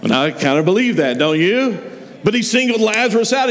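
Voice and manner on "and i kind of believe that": usual speaking voice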